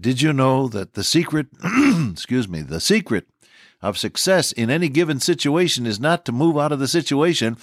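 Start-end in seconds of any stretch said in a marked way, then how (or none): none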